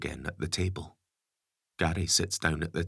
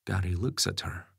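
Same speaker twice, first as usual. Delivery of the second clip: ordinary delivery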